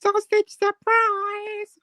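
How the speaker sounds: very high, slow squeaky voice; high-pitched voice. Acted. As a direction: stretch it out in a tiny squeak. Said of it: "that high-pitched voice".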